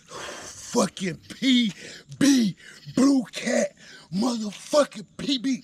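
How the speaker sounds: loud, high, jubilant yelling; excited shout. Real, not acted. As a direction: holler it out over a big catch. Shouting over a big catch.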